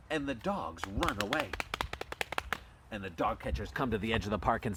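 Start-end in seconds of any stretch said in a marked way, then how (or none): none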